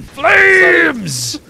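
high loud battle cry; shouting. Real, not acted. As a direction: yell as you open fire. Fires flamethrower.

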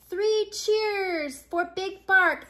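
high, slow rallying call; cheering. A narrator's reading. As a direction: lead the cheers, drawn out. Crowd calls three cheers.

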